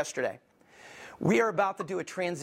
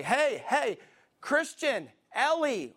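put-on higher-pitched call to the kids; parent calling out; 6 semitones higher, slower than usual. Parent to kids who aren't listening, calling out. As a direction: call each name to get attention.

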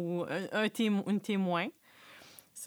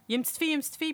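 higher, louder mock alarm; raised, excited retold call; urgent calling out; 9 semitones higher, 6 dB louder, much faster than usual. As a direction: shout it like flagging down a lifeboat. Acting out fiancé calling out.

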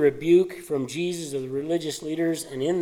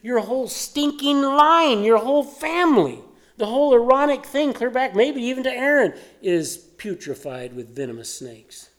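John condemning; 7 semitones higher than usual. Retold or imitated. John condemning the leaders' family line, scornful shout. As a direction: pour scorn on their whole line. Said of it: "because he says".

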